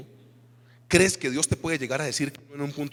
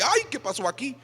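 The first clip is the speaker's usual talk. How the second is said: much higher, startled mock exclamation; shocked sister; 9 semitones higher than usual. The sister realizing the pastor is married, high mock surprise. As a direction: play the sister caught out, embarrassed.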